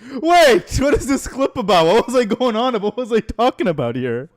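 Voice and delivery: high, loud burst of disbelief; real incredulous outcry; alarmed bafflement. Real, not acted. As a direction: demand it of yourself, high and stunned. Baffled by own old clip.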